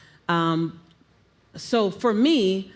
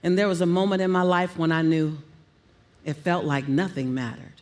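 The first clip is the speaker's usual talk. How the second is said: low verbal highlight; 5 semitones lower than usual. Shown as a demonstration. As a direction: pitch sinking low on "nothing mattered". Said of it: "play on all the keys".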